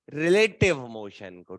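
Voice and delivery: slow, stress on "relative"; emphatic answer. Shown as a demonstration. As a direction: lean on "relative", deliberately. Teacher stressing the key answer.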